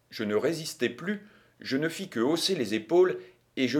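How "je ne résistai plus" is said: ordinary delivery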